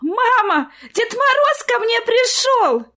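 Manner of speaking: put-on high, loud, drawn-out child's cry; overjoyed squeal; happy shouting. A narrator's reading. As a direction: shout joyfully to mum as a little girl. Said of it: "счастливо закричала Сонечка".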